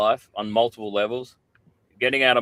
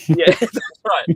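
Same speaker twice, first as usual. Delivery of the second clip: laughing; 8 semitones higher, 6 dB louder than usual. Real, not acted. Laughing agreement.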